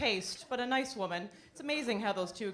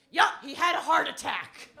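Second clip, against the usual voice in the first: put-on elderly mother quoted; 4 semitones higher, 7 dB louder than usual. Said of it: "She goes".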